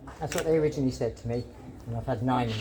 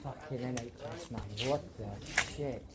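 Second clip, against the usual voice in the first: muttered curse; 3 semitones lower, 11 dB quieter than usual. Real, not acted. Muttering after leaving the doctor.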